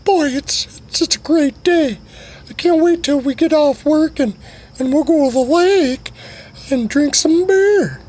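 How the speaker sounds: a high-pitched buddy excited about the lake; Sugar Tits voice. Acted. Coworker dreaming of beer at the lake, squeaky high voice. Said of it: "I'm talking to Sugar Tits, and he's like".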